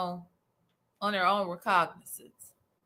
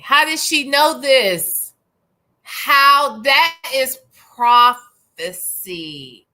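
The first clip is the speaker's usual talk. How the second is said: loud, emphatic disbelief; mock amazement; 7 dB louder, slower than usual. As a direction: marvel loudly, stretching out "prophecy". Mock amazement that she knows.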